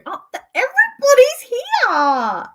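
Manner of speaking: high, loud laughing greeting; bubbling over as friends arrive; laughing delight. Real, not acted. Viewers joining the stream.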